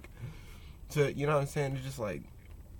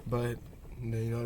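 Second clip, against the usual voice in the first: ordinary talk, nothing marked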